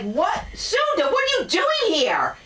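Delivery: high, drawn-out surprise; shocked to see a friend; surprised. Retold or imitated. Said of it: "Wayne Brady looks at me like".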